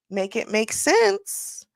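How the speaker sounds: ordinary talk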